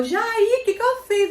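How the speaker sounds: high, loud alarmed cry of his name; panicked yelp. Retold or imitated. Asking instructor Jair what went wrong, high panicked yelp. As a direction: squeal it out like you just messed up.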